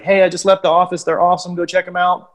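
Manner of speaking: high-pitched, upbeat off-the-cuff plug; quick video testimonial. Acted. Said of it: "I could bang out a quick".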